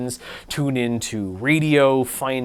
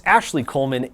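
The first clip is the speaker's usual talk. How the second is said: usual speaking voice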